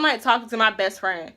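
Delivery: normal talking voice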